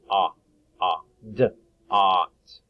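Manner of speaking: "d, ot" split into sounds; teacher sounding out a word slowly; sounding out dot. Shown as a demonstration. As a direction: break "dot" into d and ot. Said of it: "That sounds like ah".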